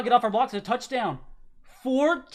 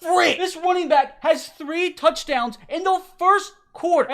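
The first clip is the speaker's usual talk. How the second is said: much higher, much louder outraged yelling; disbelieving shout; 8 semitones higher, 11 dB louder than usual. Real, not acted. Gamer yelling at the running back's third touchdown, outraged. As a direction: scream at the screen in total disbelief. Opponent's running back scores again.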